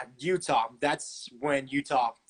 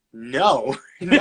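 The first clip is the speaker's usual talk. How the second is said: laughing refusal; 9 dB louder than usual. Retold or imitated. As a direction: laugh off the offer. Laughing refusal of cheer offer.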